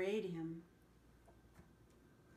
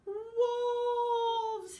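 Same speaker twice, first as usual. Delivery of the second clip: high, loud, frightened cry; scared cry; 13 semitones higher, 8 dB louder than usual. A narrator's reading. Mocha the dog hearing real wolves, high frightened cry. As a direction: cry out in terror. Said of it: "he said".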